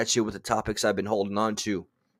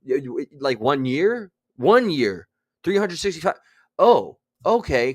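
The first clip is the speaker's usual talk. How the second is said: retold astonishment; 4 semitones higher, 4 dB louder than usual. Retelling to viewers the news of one year, astonished. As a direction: replay the double take as it sinks in. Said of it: "I was like".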